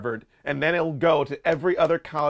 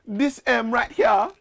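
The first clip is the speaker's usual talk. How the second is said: high, slow mock-Jamaican; bad Jamaican accent; 9 semitones higher, slower than usual. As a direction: fortune teller's terrible Jamaican accent. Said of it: "I can't do a Jamaican accent".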